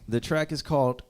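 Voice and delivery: ordinary delivery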